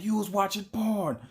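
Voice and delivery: high-pitched imitation of an accusing girlfriend; mock accusation.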